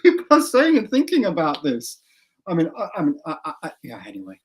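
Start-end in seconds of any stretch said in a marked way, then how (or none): 0.0-2.0 incredulous. Real, not acted. Laughing disbelief at Russia.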